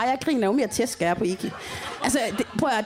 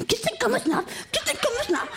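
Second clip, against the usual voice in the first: high, chanting mock voice; high-pitched mimic of a man chanting; Iki's sing-song; 12 semitones higher than usual. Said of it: "han stod sådan der".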